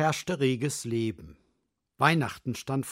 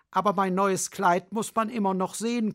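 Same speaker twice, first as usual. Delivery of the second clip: higher, preening peacock; vain peacock; 6 semitones higher, 3 dB louder than usual. Acted. Peacock insists on his dress, higher and preening. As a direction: show off the new dress.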